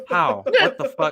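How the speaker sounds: How? jumps way up, high and loud; high incredulous squeal on How; squeaky outrage. Shown as a demonstration. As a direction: redo it as a shrill squeal of disbelief. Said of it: "do that again".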